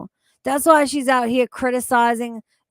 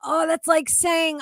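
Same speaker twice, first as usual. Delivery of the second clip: usual speaking voice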